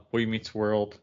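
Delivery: ordinary talk, nothing marked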